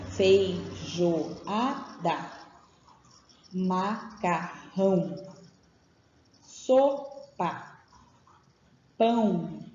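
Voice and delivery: words slowly drawn out one at a time; slow dictation. Shown as a demonstration. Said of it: "as palavras que ele escreveu".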